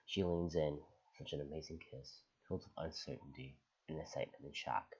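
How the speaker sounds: low, soft storytelling voice; hushed romantic story reading. Acted. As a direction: read it quietly, like an intimate confession. Reads a romantic post aloud.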